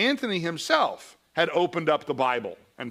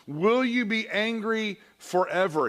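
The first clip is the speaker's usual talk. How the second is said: higher, slow plea to God; a touch of a mourning psalmist; psalmist lament; 8 semitones higher, slower than usual. Acted. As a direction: let the how long hang like a psalm. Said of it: "It's like the psalmist".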